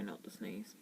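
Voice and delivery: soft whisper after a held sneeze. Real, not acted. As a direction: breathe it out in a whisper. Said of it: "Try not to sneeze".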